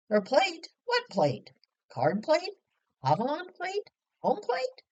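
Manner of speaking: high and loud joking, pitch climbing with each plate; teasing mock questions. Grown children teasing Mother at breakfast, loud mock questions. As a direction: ham up the puzzlement to get a laugh.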